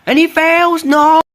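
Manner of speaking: shrieked dismay, very high; shrieking jackpot winner. Real, not acted. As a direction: scream the fail out. Commentator reacts to a failure.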